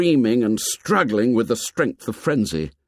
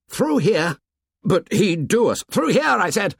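put-on high, urgent boyish cries; excited hunt calls; urgent calling; 9 semitones higher than usual. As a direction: call out mid-chase, pitched high. Boys shouting during boar chase.

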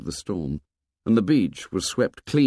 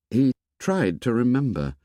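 plain reading voice

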